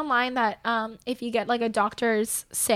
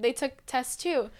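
ordinary delivery

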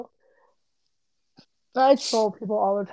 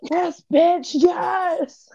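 higher, louder cheer of a hyped fan; mock cheering shout; 8 semitones higher, 6 dB louder, much slower than usual. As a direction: shout like you're lost in the battle scene. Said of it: "I was the loudest person".

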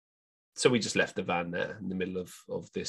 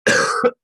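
loud chesty hacking cough; coughing fit; 2 semitones lower, 10 dB louder than usual. Real, not acted. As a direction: cough it out hard. Sick speaker coughing before speaking.

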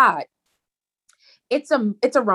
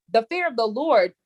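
ordinary talk

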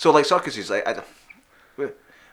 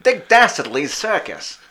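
high, loud, slow cartoon villain; Dick Dastardly; 8 semitones higher, 8 dB louder, much slower than usual. Host announcing the villain's circus, sketchy showman voice. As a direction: announce it slowly as a scheming showman. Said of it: "trying to go like Dick Dastardly".

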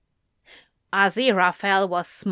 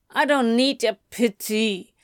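sulky muffled protest. A narrator's reading. Anthony under the sofa cushions refusing pity, muffled. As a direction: speak as if buried in cushions. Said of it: "came a muffled".

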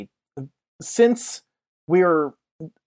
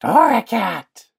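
cat cry; 7 semitones higher, 3 dB louder, slower than usual. Acted. GM voicing cat creature.

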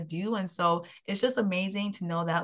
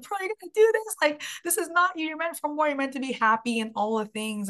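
mirror pep talk; 9 semitones higher than usual. Retold or imitated. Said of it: "I gave myself a pep talk in the mirror".

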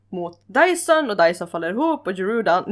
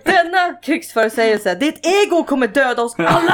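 mock angry outburst; 6 semitones higher, 5 dB louder than usual. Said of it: "Och så här".

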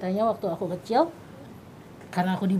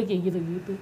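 ordinary talk

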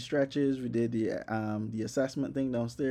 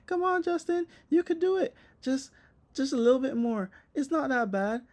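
put-on much higher, bubbly encouraging coach girl; cheery Sarah; 11 semitones higher than usual. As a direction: cheer him on like a peppy gym buddy. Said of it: "real cheery and shit".

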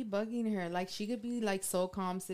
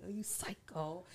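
hushed retold reaction; 8 dB quieter than usual. Retelling own reaction to threat.

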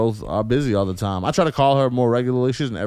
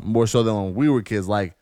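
usual speaking voice

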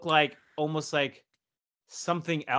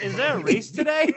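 higher and louder, disbelieving; incredulous; 10 semitones higher, 5 dB louder than usual. Retold or imitated. Storyteller on a bus driving a racetrack, amazed disbelief.

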